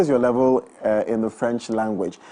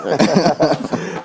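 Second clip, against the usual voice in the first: higher burst of chuckles; teasing laugh; 4 semitones higher, 3 dB louder than usual. Real, not acted. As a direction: laugh at your own joke about the guest. Teasing guest about his accent.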